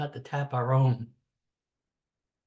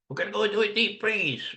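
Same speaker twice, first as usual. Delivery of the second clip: mock doomsayer; 6 semitones higher than usual. Mocking seventies warnings.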